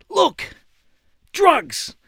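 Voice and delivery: high, punched mock exclamations; acted shock, high with a falling end; imitated fired-up alarm. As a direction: imitate an old man scandalised by his discovery. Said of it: "He was that fired up".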